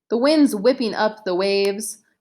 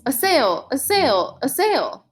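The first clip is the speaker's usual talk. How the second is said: higher-pitched call, only lightly raised; understated narrated yell, pitched up; mild mock yell; 5 semitones higher than usual. A narrator's reading. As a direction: call it out like a lookout, without full volume. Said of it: "A voice yells".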